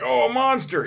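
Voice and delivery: high, loud mocking "monster" cry; mock fright. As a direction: play the dumb panicked villager. Villagers spot Chopper.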